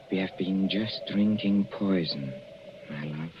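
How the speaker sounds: soft, low and cold, almost tender; quiet menace. Acted. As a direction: purr the threat gently. Husband reveals he poisoned them.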